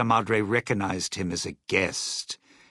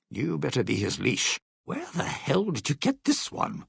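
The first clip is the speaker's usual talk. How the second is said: ordinary delivery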